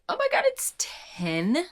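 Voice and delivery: high, rising cry of surprise; shocked exclamation. Real, not acted. Reader sees how late it is, shocked and high-pitched.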